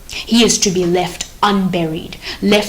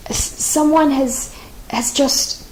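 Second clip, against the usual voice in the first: slow, higher, halting stammer; nervous stammer; 6 semitones higher, slower than usual. Acted. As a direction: stumble over the words in fear. Sentry stammers bad news.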